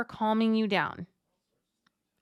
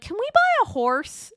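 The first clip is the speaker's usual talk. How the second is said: high, bright imitated child voice; much higher child voice asking for a horse; kid asking; 12 semitones higher, 3 dB louder than usual. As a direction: mimic a little kid begging out of nowhere. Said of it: "somebody walks up and is like".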